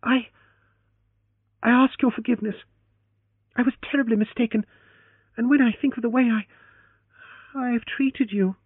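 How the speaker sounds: high, fast, halting apology; a flustered, faltering plea. Acted. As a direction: stumble through a flustered apology. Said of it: "as she faltered".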